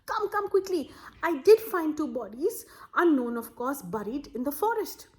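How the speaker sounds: high, loud and urgent; urgent calling. Acted. Villager rushes home calling family urgently. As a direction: hurry them along breathlessly.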